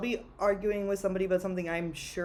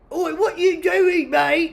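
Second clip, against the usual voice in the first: a hammed-up British Cockney accent, much higher, louder and slow; mock Cockney accent; 10 semitones higher, 11 dB louder, much slower than usual. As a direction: shout it like a cartoon British bloke. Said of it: "Like that".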